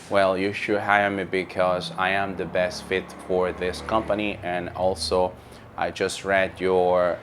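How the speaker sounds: low, soft, hesitant monotone answer; imitating a flat trainee. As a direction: mimic a nervous trainee mumbling flatly. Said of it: "So that is wrong".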